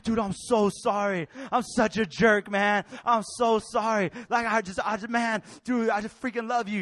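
loud, drawn-out imitation of a remorseful brother; over-apologize, leaning hard on the words; hammed-up heartfelt apology; gushing apology. Brother's acted emotional apology.

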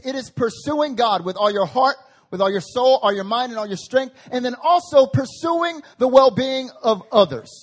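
loud, ringing preacher cadence, rising on each phrase; full-voiced sermon climax; preaching at full force. Real, not acted. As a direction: lift the congregation with every line.

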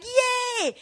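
high joyful cheer; gleeful yay. Retold or imitated. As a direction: cheer like a delighted child. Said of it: "I was like".

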